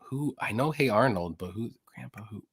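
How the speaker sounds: ordinary delivery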